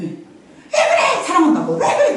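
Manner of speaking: very high exasperated mom; mock shrill protest; high-pitched mock exasperation; irritated mother.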